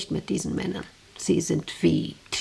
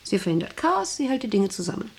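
ordinary delivery

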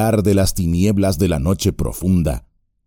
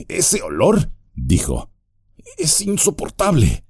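higher voice, recoiling in disgust; disgusted hobbit; 9 semitones higher than usual. Acted. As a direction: react to a foul stench. Sam disgusted by stench. (hobbit asqueado)

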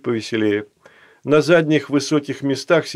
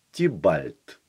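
low voice; 5 semitones lower than usual. Acted. Настасья introduces Tibalt.

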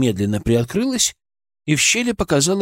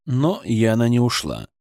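plain reading voice

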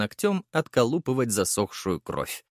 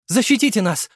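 loud high desperate plea; blurting; 10 semitones higher, 6 dB louder than usual. A narrator's reading. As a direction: blurt it out in panic. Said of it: "выпалила она".

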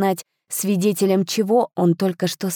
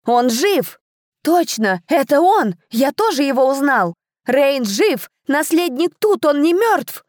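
excited crowd shouts; 8 semitones higher than usual. A narrator's reading. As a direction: shout the news in amazement. Crowd recognises the living heir.

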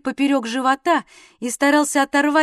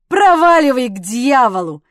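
forceful, bellowed oath; angry curse; 9 dB louder than usual. A narrator's reading. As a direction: roar at him to clear off. Drunkard curses his brother-in-law.

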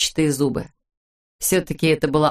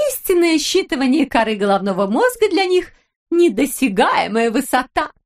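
cooing wife; 8 semitones higher than usual. A narrator's reading. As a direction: coo at your husband. Wife character voice. (выше и громче, сюсюкая)

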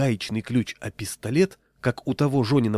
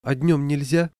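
timidly ask, pitch raised; a meek, higher-pitched question; 4 semitones higher, 3 dB louder than usual. A narrator's reading. Patient asks the doctor about daytime walks, timid. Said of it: "робко сказал я".

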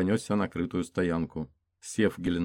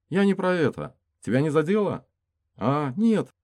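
character voice; 10 semitones higher, 6 dB louder than usual. Acted. Character dialogue higher.

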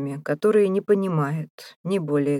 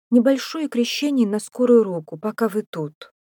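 higher-pitched woman making a request; lightly acted female voice, raised in pitch; woman's request; 6 semitones higher, 5 dB louder than usual. A narrator's reading. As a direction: lift the voice high for her polite ask. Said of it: "попросила Мэйвис".

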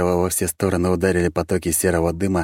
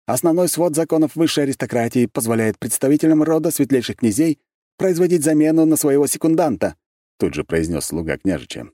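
formal servant; 8 semitones higher, 4 dB louder than usual. A narrator's reading. Servant cites law.

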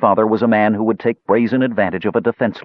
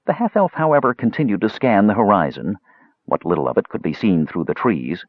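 usual speaking voice

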